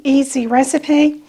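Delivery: normal talking voice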